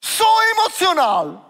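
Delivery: sudden high emotional shout; replaying his own outburst loudly. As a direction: burst out, voice flying up. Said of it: "da bin ich so verrückt worden".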